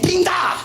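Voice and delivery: high, shouted comic sound effect; imitated nail impact. Imitates nail hitting hand.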